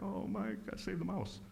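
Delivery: a hint of tears, begging for the mouse; tearful plea. Recalling crying over the mouse, a soft tearful plea. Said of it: "And I started crying".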